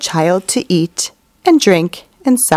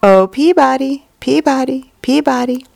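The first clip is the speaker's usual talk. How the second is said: higher, sing-song birdcall; sparrow's call; 5 semitones higher than usual. A narrator's reading. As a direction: lilt it like a sparrow calling from the woods.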